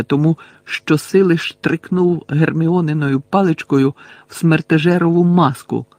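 ordinary delivery, nothing marked

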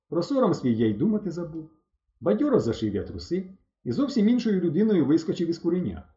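ordinary delivery

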